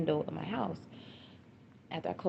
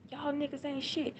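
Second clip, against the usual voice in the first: retold defiant cursing, high-pitched; high, running tirade, not shouted; talking mad shit; 10 semitones higher than usual. Daughter cursing out her attackers as mother retells, talking mad shit. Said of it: "She just talking mad shit".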